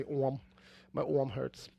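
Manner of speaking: "orm" with the vowel of "or"; regional "orm". Shown as a demonstration. Showing friends how they say arm, rounded "orm". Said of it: "That's how I say orm".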